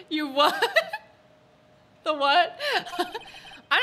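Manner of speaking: real laughter breaking through words; high, giggling disbelief; cracking up. Real, not acted. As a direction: laugh while trying to talk. Cracks up at the game line.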